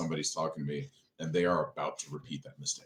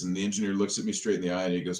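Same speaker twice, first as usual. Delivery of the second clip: ordinary talk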